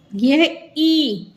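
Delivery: ई held long and slow; drawn out long ई vowel. Shown as a demonstration. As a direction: stretch the ई sound out. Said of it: "खींच के बोल रहे हैं ना".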